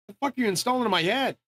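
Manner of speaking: high, indignant demand; retold alarmed protest. Patient questioning doctors about his head, retold indignant alarm.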